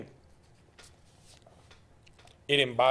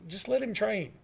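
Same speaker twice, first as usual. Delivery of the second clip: ordinary talk, nothing marked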